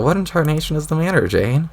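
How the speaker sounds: ordinary talk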